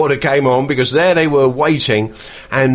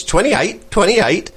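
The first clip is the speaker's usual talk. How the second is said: higher, chirpy two-note parrot call; parrot call imitation; 7 semitones higher, slower than usual. To listener, imitating the western ringneck's call, higher and sing-song. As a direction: mimic the ringneck calling its name. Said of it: "sort of says".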